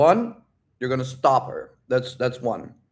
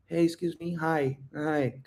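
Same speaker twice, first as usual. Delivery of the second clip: too quiet to hear; 3 semitones higher, 8 dB quieter, slower than usual. Shown as a demonstration. Coach shows how not to approach, small quiet voice. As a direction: barely dare to get her attention. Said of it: "I'm not like".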